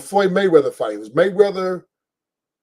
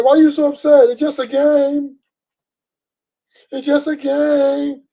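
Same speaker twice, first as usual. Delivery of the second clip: pitched way up and slow, drawn-out mocking; mock whining woman; 9 semitones higher, slower than usual. Mocking his woman calming him at the game, high and slow. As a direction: stretch it like a whiny girlfriend. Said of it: "You know".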